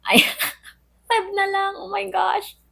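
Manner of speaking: high laughing giggle, giving up. Real, not acted. Vlogger gives up on February and laughs, high giggle. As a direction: laugh at your own failure.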